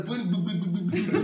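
ordinary delivery

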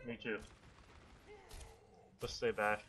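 normal talking voice

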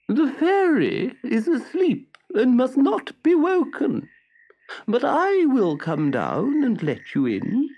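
high, very slow creature voice; slow snail. A narrator's reading. The snail answers Pinocchio from upstairs, very slow. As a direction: stretch every word lazily.

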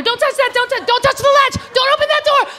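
high, sharp warnings; frantic mom warning. Retold or imitated. Mom guarding the stall from her toddler, high and urgent. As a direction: bark orders at a toddler.

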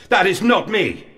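high, insistent protest; anguished denial. Acted. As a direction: cry it out in refusal. Denies the accusing words as his.

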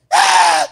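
sudden loud "ah!"; mock startled yell; acted shout of alarm. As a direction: cry out as if someone just fell. Acts the fall after warnings.

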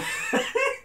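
short high chuckle; light, high laugh; high giggle. Real, not acted. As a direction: let out a quick giggle. Giggle after aside.

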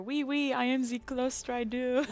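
cartoonish French-accented English; mock French accent. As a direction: play a French Claw Strider. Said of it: "French Claw Strider".

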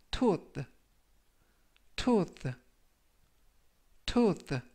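tooth said clearly, three times; teacher modelling tooth; model pronunciation. Shown as a demonstration. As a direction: repeat tooth carefully for learners. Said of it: "라고 발음하시면 되겠고요".

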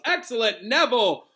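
cried out praise. A narrator's reading. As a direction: cheer the pupil on loudly. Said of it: "cried Professor Lupin".

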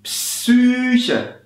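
"Psyche" with a long Ü sound; model word. Shown as a demonstration. Teacher modelling "Psyche" again. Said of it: "Wie ihr hört".

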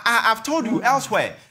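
usual speaking voice

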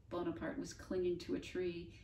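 ordinary delivery

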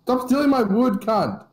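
annoyed yell. Real, not acted. Player tells friend to stop stealing wood, annoyed yell. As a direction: yell it at a thieving teammate.

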